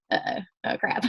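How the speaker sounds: breathy whispered dismay, voice almost gone. Retold or imitated. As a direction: whisper it like you just got caught. Retold dismay at sister's reply.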